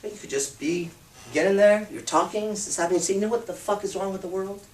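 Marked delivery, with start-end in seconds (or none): 3.1-4.6 smiling, soft and teasing; with a smile. Shown as a demonstration. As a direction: ask it with a grin. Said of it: "With a smile".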